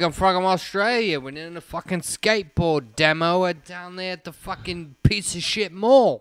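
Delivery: broad put-on Australian; Australian accent. Skateboarder telling women where he's from, Australian accent. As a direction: lay on a hammed-up Australian accent. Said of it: "his accent's so hot".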